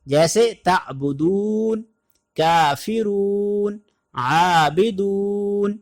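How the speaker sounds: تعبدون کافرون عابدون said slowly, the و stretched; lengthened waw. Shown as a demonstration. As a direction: stretch the long u in each word. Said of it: "تھوڑا سا اس کو لمبا".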